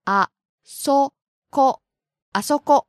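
ko, ko, koko built up one syllable at a time; slow syllable by syllable. Shown as a demonstration. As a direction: break koko into syllables, then say it whole. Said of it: "Please say them, [name] sensei".

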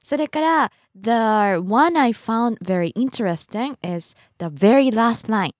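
ordinary talk, nothing marked

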